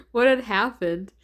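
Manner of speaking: laughing through the question; a real laugh breaking into the line. Real, not acted. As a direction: giggle it out in disbelief. Reader laughing at the scene.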